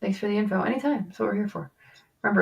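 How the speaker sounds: normal talking voice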